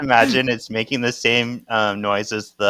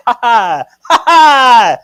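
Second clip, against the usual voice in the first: high, loud ha ha, then a slower, gloating you did it; triumphant laughter; 13 semitones higher, 10 dB louder, slower than usual. Retold or imitated. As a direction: cackle in victory, then savour it. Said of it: "he starts laughing 'cause he did it".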